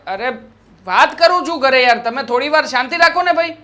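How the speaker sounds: ordinary talk, nothing marked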